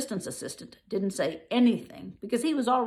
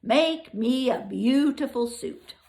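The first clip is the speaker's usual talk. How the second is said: lion demanding; 5 semitones higher, 6 dB louder, slower than usual. Acted. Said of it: "lion demands".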